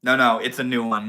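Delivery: usual speaking voice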